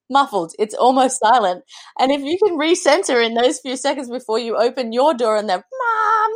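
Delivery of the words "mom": put-on child calling out. Kids start calling again.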